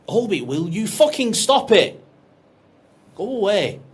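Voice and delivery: shouting at the dog to go away; telling off the dog. Real, not acted. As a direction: snap at the dog to stop. Scolding the dog mid-talk.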